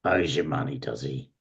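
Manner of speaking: low, soft aside from a servant; quietly suspicious servant, voice dropping; surly servant. Acted. Surly servant questioning a caller.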